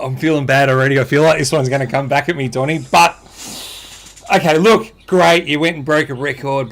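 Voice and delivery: ordinary talk, nothing marked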